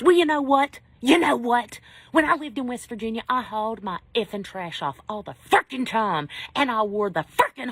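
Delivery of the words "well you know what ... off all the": louder, higher, fired-up comeback; imagined retort. Retold or imitated. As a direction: let the bottled-up anger burst out. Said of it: "I did not say that to him".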